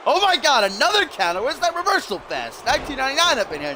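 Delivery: high-pitched, amazed commentary; excited play-by-play. Real, not acted. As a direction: react to the action in a high, excited voice.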